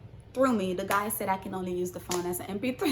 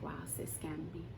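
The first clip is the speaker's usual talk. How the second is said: stunned whisper; 5 semitones lower, 14 dB quieter, faster than usual. Real, not acted. Realising she was scammed.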